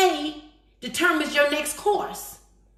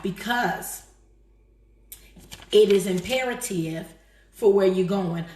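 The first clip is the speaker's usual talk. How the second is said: slow, lowered, weighty exhortation; solemn preaching; 5 semitones lower, slower than usual. Real, not acted. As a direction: let each phrase land with long pauses.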